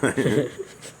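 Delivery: high loud laugh; burst of laughter. Real, not acted. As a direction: laugh out at the typo. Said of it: "it says hose in mine".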